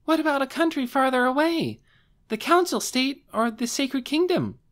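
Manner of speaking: put-on eager high girl. Shalltear eagerly suggesting distant countries, high and loud. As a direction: pipe up eagerly.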